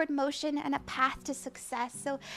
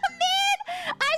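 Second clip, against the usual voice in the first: nervous laugh. Real, not acted. Laughing at own awkwardness.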